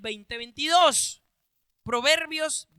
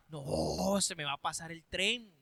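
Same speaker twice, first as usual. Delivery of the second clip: softer, lower, a touch of mock worry; worried sister; 7 semitones lower, 8 dB quieter than usual. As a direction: mutter it like a fretful sister. Sister fears missing her chance.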